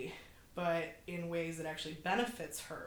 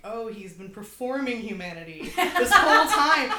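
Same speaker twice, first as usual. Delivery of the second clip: louder, higher, laughing through the words; laughing realization; 4 semitones higher, 14 dB louder than usual. Retold or imitated. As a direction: blurt it out mid-laugh, amused. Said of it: "You can watch him and be like".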